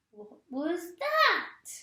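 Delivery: put-on high, slow startled cry from a cranky old wife; Missus Twit crying out. A narrator's reading. Missus Twit feels something land on her head, high startled cry. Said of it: "cried Missus Twit".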